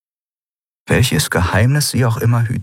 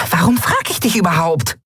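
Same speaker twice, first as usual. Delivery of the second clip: high, exasperated little voice; piqued, high-pitched brush-off; huffy; 11 semitones higher than usual. Acted. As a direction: dismiss them in a high, offended voice. Offended friend turns away.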